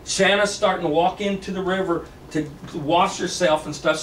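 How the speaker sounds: ordinary delivery, nothing marked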